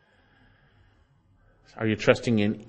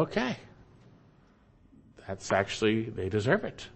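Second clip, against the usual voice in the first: softer, conceding they deserve it; quiet resigned agreement; 7 dB quieter than usual. Retold or imitated. Believer realizing judgment is just, quietly conceding. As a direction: settle into quiet acceptance. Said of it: "you go".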